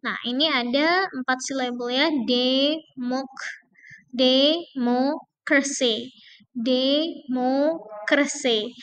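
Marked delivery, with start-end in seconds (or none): 4.1-8.7 demokrasi, very slow, MO stressed. Shown as a demonstration. Teacher slowly models stress on MO. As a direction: stretch it out, lean on MO. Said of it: "yang akan kita stress adalah tiga dari belakang".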